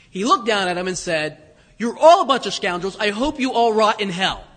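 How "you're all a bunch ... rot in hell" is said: hammed-up louder mock fury; mock outrage. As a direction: fake a loud, cursing rant as a joke. Joking fake Jesus reply.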